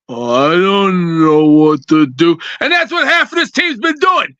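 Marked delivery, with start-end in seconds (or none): 0.1-2.4 low, slow, lightly mocking whine; mock clueless player. Caller mocking players who freeze on the field, low and slow. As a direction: mimic a lost player, drawn out. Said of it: "Don't stand there and go".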